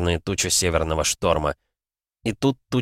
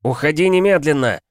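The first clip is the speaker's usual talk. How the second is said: loud, high command without a waver; firm, urgent yell; shouted order; 8 semitones higher, 5 dB louder than usual. A narrator's reading. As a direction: drive them off at once. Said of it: "закричал он".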